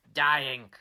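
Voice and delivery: ordinary delivery, nothing marked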